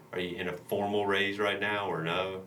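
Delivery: ordinary delivery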